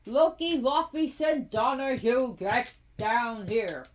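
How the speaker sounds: loud, high scolding call at the cat; yelling. Real, not acted. Owner yelling at the cat to get down here, real yelling. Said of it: "yep, I'm yelling".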